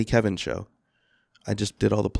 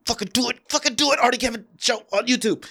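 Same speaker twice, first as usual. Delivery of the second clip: hammed-up high-pitched forced plug; frantic gunpoint plug; 11 semitones higher than usual. Guest mocking his plug at gunpoint, frantic. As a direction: blurt it high, as if under orders.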